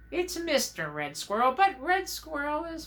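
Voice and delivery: high puppet squirrel voice; Red Squirrel. Acted. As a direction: answer in a high, measured critter voice. Red Squirrel puppet answers host.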